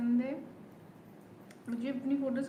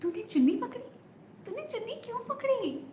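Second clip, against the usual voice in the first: much higher, playful mock indignation; heroine's shy accusation; 10 semitones higher than usual. Heroine asking the soldier who held her scarf, high playful. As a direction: toss the question up high and teasing. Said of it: "तो वो देखती है".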